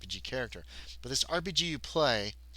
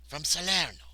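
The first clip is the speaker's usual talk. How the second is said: put-on slightly higher Italian-accented voice; 5 semitones higher, 3 dB louder than usual. A narrator's reading. Worker telling the player his hometown, Italian accent. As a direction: say the town with an Italian lilt. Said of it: "he's got an Italian accent".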